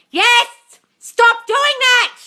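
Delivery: high, loud, slow drawn-out shout; loud scolding. Real, not acted. Host scolding a viewer who vents, loud shout. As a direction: yell the order at the viewer.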